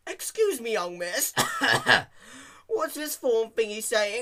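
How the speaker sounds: put-on high, loud creaky old man with cough. A narrator's reading. Old Pegasus repeating his question to Dash, high creaky cry. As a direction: cry it out again, forgetful. Elderly pony again.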